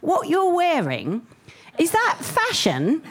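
put-on high, slow little boy voice; six-year-old English son. Son questioning mum's outfit, imitated high, slow English child voice. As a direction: imitate a well-spoken child, drawn out. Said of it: "And he goes".

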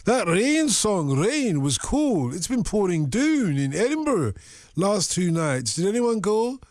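slow, drawn-out Edinburgh accent, RAIN and COOL stretched; host reading a Scottish listener's text in his accent. Shown as a demonstration. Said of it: "I've got to do it in the accent".

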